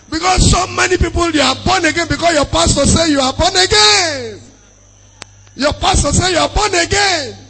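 high, driving preacher's voice; full-force preaching. Acted. Preacher challenging those who claim to be born again, full-force preaching.

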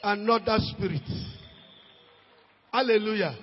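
ordinary talk

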